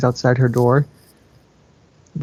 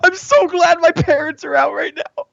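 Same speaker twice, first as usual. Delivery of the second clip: much higher, giddy joke; gleeful squeal. Real, not acted. Reader joking about parents, giddy and squealing. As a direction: squeal it with glee.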